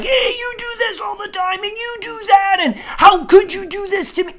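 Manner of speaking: high, loud accusing yell; mock accusing shout. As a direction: hammer them with blame, shouting. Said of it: "it's not like".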